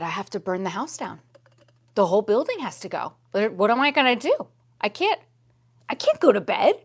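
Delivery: fast, loud, rising panic; mock panic. Retelling her panic at the spider, fast and loud. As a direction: rush it like you're freaking out.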